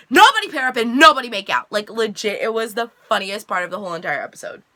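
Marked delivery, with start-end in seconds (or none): 0.1-1.7 loud, higher mock yell; frantic shouted order. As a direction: imitate yelling a panicked veto to the room. Retold frantic order to the crowd.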